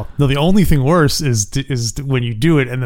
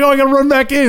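high, eager burst; lightly excited rush; 12 semitones higher, faster than usual. Retold or imitated. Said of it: "you're like".

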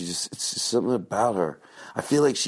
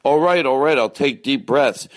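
mock flustered; 6 semitones higher, 5 dB louder than usual. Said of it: "You didn't have to yell at me".

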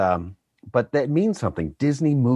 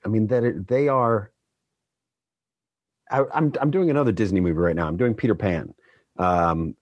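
ordinary delivery

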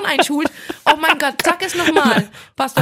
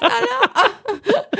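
laughing; 13 semitones higher than usual. Real, not acted. Laughing after mock insults.

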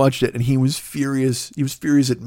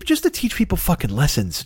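ordinary talk

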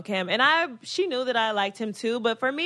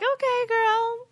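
high, slow humoring reply; lilting, noncommittal retort; playing it cool; 12 semitones higher, much slower than usual. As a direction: humor her, drawn out and high. Acting her own cool reply.

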